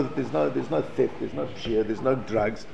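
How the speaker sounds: usual speaking voice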